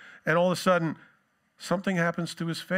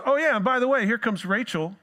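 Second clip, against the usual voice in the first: offhand shepherds; 6 semitones higher, 4 dB louder than usual. Retold or imitated. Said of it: "all of a sudden they goes".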